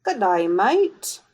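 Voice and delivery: slow, drawn-out Aussie greeting; exaggerated, slow Australian attempt; Australian accent. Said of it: "in an Australian accent".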